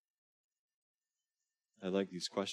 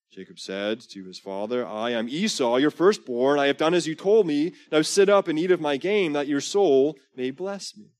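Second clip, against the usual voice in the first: louder, higher storytelling read of scripture; dramatic scripture reading; 7 semitones higher, 12 dB louder than usual. Acted. Preacher reading Genesis aloud to the congregation, loud and dramatic. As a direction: read the Bible scene out big for the room.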